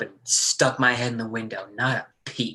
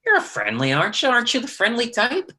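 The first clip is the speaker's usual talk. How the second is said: mocking sneer; 11 semitones higher than usual. Lee needling his brother.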